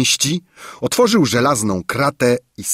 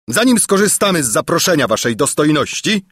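brisk, raised, pointed reply; emphatic; 6 semitones higher, faster than usual. A narrator's reading. Captain answering the king's offer of drink, emphatic. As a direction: state it firmly, fast and high. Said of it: "rzekł dobitnie".

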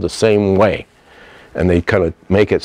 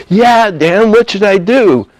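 put-on high-pitched pleading boy; acted helpless youngster; imitated anxious kid; 10 semitones higher, slower than usual. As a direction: plead like a worried child, pitched up. Retold frightened kid asking for help.

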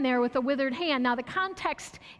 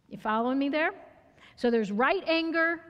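ordinary talk, nothing marked

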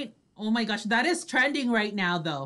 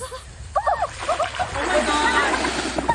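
awed exclamation; 11 semitones higher, much slower than usual. Real, not acted. Watching the crocodile video.

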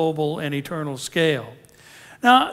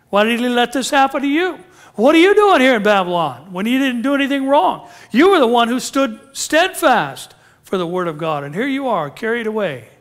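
higher, louder mocking challenge; devil's accusing taunts; 5 semitones higher, 6 dB louder than usual. Devil taunting the captives in Babylon, accusing. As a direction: goad them with pointed questions. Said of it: "he was talking like this".

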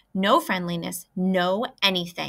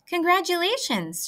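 shouted; 10 semitones higher than usual. A narrator's reading. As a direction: call out the congratulations to the goose. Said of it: "shouted Wilbur".

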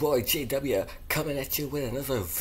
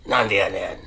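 deep voice; 9 semitones lower, 5 dB louder than usual. Acted. Punchline after greeting.

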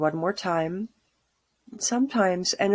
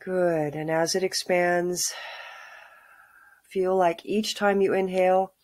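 calm grounding exercise. Acted. Guided grounding meditation.

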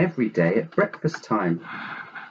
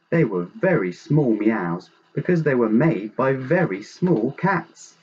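ordinary delivery

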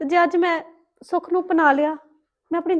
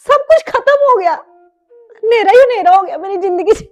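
loud, high-pitched lament; anguished outburst; 5 semitones higher, 6 dB louder than usual. Acted. Mother blaming her child for her ruined life, anguished. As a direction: cry out in despair.

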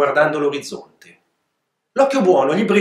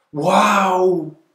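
exclaiming; 4 semitones higher than usual. Acted. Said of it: "esclamò Martina".

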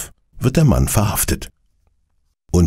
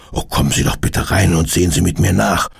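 plain reading voice

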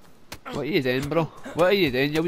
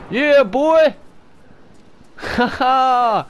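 triumphant whoop; 6 semitones higher, 5 dB louder, much slower than usual. Real, not acted. Celebrating a kill.

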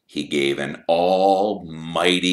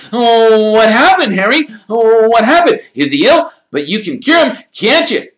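high, loud, breathless eager chatter; young boy babbling with excitement; excited Colin; 13 dB louder than usual. A narrator's reading. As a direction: gush at Harry, thrilled. Colin fussing over Ron.

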